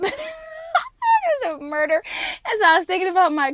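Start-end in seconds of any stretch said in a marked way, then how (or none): 0.0-2.0 laughing through words. Real, not acted. Laughing at herself.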